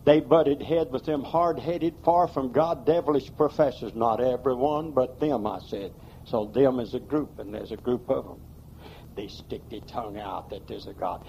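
usual speaking voice

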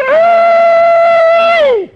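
long, high, piercing whoop; imitated holler. Imitating the woman's holler at the testimony meeting, long high whoop. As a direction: whoop loud and high, holding it out. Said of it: "B got up and said".

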